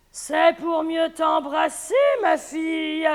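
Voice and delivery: slow, loud, growly; big bad wolf. Wolf answering the girl, loud and slow. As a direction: rumble it like the wolf.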